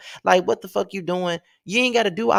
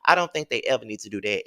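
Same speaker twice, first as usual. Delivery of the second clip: usual speaking voice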